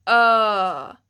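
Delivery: loud "ö" from deep in the throat; deep vowel. Shown as a demonstration. Teacher showing ö sound, deep. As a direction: let "ö" vibrate low. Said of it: "feel it vibrating here in your throat".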